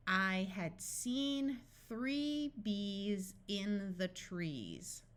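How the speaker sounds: slow but joined into one sentence; measured, connected model reading; slow practice reading. Shown as a demonstration. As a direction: reread slowly and evenly for learners. Whole sentence reread more smoothly.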